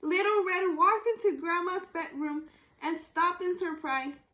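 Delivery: usual speaking voice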